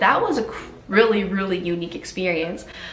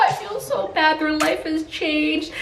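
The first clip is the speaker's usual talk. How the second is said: imitating her bawling; 7 semitones higher than usual. Mom crying in hospital about her miserable dogs, teary wail. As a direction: wail it as if the tears are coming. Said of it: "I just started bawling".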